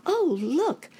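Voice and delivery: put-on high, loud child exclamation; boy crying out. A narrator's reading. Boy rabbit looking over the basket, high alarmed cry. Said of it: "cried Sammy".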